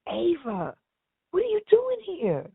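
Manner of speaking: high, astonished cry of her name; a bodyguard caught off guard by her; surprised. Retold or imitated. Stewie surprised to see her.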